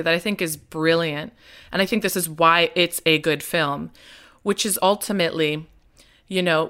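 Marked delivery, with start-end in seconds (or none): none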